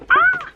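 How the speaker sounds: short, high, loud "ah"; sudden sharp squeal; startled yelp. Real, not acted. Makeup vlogger caught out while working with the tube, startled yelp.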